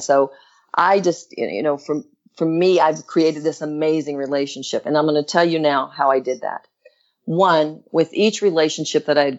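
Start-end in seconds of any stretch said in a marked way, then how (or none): none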